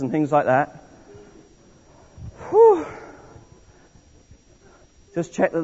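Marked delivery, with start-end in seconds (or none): 2.5-2.9 high mock heat whew. Shown as a demonstration. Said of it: "don't forget to be hot".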